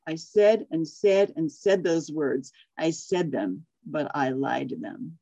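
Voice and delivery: storybook reading, lively and even; story narration. Acted. Reading a Seuss story to the class, storyteller voice. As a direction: read the tale to the group like a picture book.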